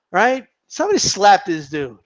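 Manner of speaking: usual speaking voice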